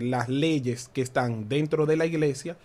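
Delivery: ordinary talk, nothing marked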